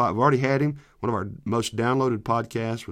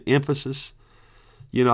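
ordinary talk

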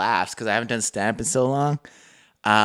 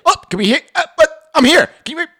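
very high, loud fumbling; mock laggy caller; 6 dB louder, faster than usual. Student cutting back into the call, very high. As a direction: call out confused. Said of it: "it's like".

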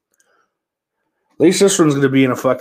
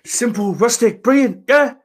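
high, loud hammed-up Scottish chef voice; Gordon Ramsay impression; 11 semitones higher, 5 dB louder than usual. Host imitates Gordon Ramsay tasting food, Scottish chef voice. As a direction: ham up a Scottish TV chef praising a dish. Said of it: "Is Gordon Ramsay Scottish".

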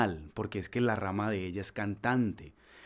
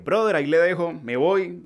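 loud casual goodbye; 7 semitones higher, 9 dB louder than usual. Retold or imitated. Telling producer he's leaving.

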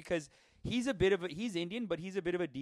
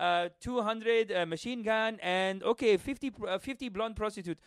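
put-on higher-pitched Arabic-accented weapons buyer; mock Arabic accent; 7 semitones higher than usual. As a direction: place the order in a hammed-up Arabic accent. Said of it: "a guy who's ordering a bunch of weapons".

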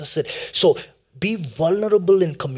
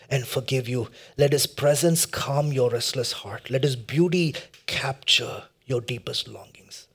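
gentle blessing; 2 semitones lower than usual. Real, not acted. Preacher blessing listeners with Christ's comfort, gentle and slow.

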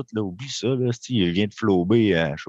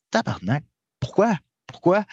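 frustrated why; 7 semitones higher than usual. Retold or imitated. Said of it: "tu fais comme".

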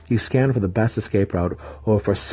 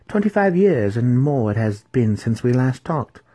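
haughty matron; 6 semitones higher, 4 dB louder than usual. Acted. Matron speaks to him.

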